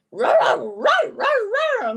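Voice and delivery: loud, high mock ruffs; imitated barking child. Child at school copying mom's bark, high loud ruffs. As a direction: bark like a kid copying a dog. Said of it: "go to school and be like".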